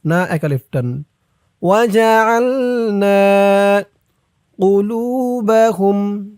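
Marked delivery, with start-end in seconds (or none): 1.6-6.3 slow melodic tajweed chant; Quran recitation. Shown as a demonstration. Recites phrase. (ধীর সুরেলা কুরআন তিলাওয়াত)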